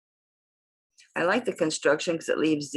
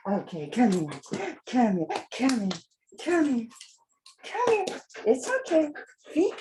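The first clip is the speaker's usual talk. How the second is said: each come here climbing much higher; calling her nervous dog higher and higher; building coaxing call; 8 semitones higher than usual. Real, not acted. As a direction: coax the dog, rising in pitch each time. Repeatedly calling the hesitant dog.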